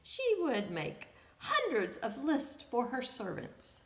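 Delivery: ordinary delivery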